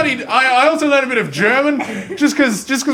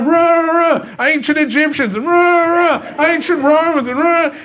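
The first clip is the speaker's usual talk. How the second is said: mock ranting teacher; 8 semitones higher than usual. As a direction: rave like a history teacher possessed. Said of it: "he's all ranting and raving".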